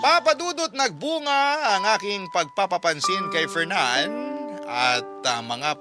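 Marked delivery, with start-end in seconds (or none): none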